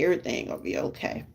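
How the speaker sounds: fast breathy whisper to yourself; whispering reassurance to herself on the boat; whispered prayer. Retold or imitated. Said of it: "everything praying and saying".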